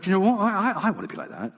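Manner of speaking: high-pitched, excited inner voice; giddy mock enthusiasm; eager self-talk. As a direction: act out a sudden keen wish. Acting out his wish to run.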